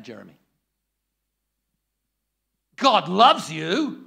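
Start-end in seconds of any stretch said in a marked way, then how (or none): 2.8-4.0 shouted loud and slow, furious; angry. Shown as a demonstration. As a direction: bark God's love like a threat. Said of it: "He's angry".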